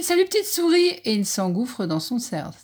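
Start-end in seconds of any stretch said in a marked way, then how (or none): 0.0-0.9 high, loud, frantic farewell; panicked goodbye. Acted. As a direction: blurt it while fleeing. Fox flees in panic.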